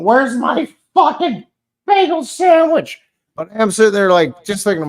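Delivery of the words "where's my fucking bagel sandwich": high, drawn-out mock yell; irate customer. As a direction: demand it like an impatient driver on the horn. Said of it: "laying on the horn going".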